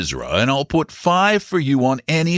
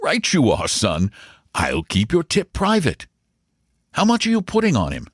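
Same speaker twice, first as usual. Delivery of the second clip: higher, mildly uneasy uncle; uneasy amusement; 7 semitones higher than usual. A narrator's reading. Uncle promising his nephew to keep the tip private, mildly uneasy. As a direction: humour the boy, a touch uneasily. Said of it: "laughed uneasily".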